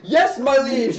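loud, high knightly reply; devoted knight shouting back; eager loyal knight. Acted. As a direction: answer the summons at full volume. Second knight answers the call.

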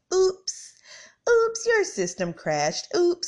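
high, loud singsong oops; cartoonish high oops, slowed and loud; mock computer taunt. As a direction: taunt like a smug website. Voicing the website crashing.

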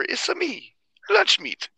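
high, exaggerated Mario Italian accent; hammy video-game Italian accent. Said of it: "appropriate an Italian accent".

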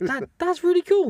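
bursting with real delight; thrilled, can't help gushing; excited. Real, not acted. Amazed reaction to film fact.

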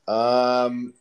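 ordinary talk

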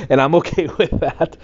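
high-pitched laugh through words. Real, not acted. Laughing about haters.

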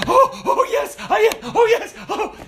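very high, loud yelping glee; excited dog. Acted. Dog answering its owner's insult, ecstatic high-pitched voice. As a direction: pant and squeal like an overjoyed dog. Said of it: "it would jump and be like".

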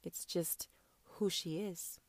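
breathless unvoiced whisper; soft reverent whisper. Real, not acted. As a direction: hush it down to a secret.

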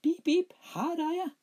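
very high, loud piping squeak; squeaky boy voice. A narrator's reading. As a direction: pipe it like a tiny boy. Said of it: "sa Smørbukk".

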